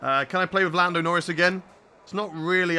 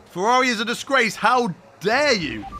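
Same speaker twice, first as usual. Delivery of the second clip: mock outrage; 3 semitones higher than usual. Streamer defending his team from chat, mock outraged. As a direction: pretend indignation.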